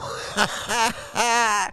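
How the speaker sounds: high, breathy chuckle in a run; amused laugh. Real, not acted. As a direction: burst out chuckling at your own story. Laughs at the retold line.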